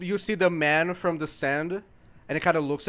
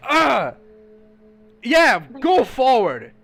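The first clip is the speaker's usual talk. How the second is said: loud furious yelling, higher; angry shout; 5 semitones higher, 7 dB louder than usual. Acted. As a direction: chase him off yelling. Vendor yells at monster.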